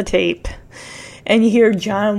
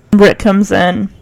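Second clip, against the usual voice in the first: ordinary delivery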